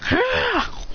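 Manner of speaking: short high-pitched yelp; mock fighting cry; acted cry. Acts out cry on meeting enemy.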